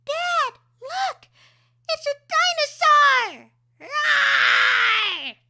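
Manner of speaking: put-on very high, loud kid voice; little kid roaring at a toy dinosaur; excited child. Shown as a demonstration. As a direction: shout like a thrilled little kid. Child character in reel.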